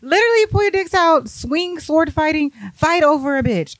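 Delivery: high, hyped-up cry for a fight; hammed-up high-pitched cheering; mock egging-on. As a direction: egg them on like a rowdy onlooker. Egging on two rivals.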